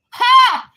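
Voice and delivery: one loud, high, harsh bark of a laugh; bitter mocking ha; bitter scoffing ha. Real, not acted. Bitter scoff mid-rant.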